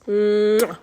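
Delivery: a loud smacking kiss; kiss sound. Acted. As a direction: plant a big noisy kiss on the cheek. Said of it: "she gives him a kiss".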